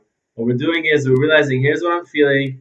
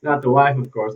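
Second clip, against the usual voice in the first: ordinary talk, nothing marked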